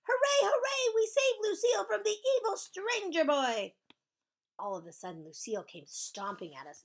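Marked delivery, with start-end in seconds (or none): 0.1-3.8 jubilant, high-pitched shouting; loud, very high victory cheer; shouted cheer. Acted. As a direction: shout it in triumph. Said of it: "we shouted".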